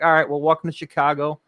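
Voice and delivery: put-on a light Chicago send-off accent; lightly Chicago-accented, cheeky goodbye; a touch of Chicago accent. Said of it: "The way you say Chicago".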